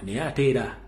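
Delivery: ordinary delivery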